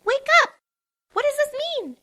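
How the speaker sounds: high pleading yell; loud high Pinkie. A narrator's reading. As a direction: shout to wake a sleeper. Pinkie shaking Twilight.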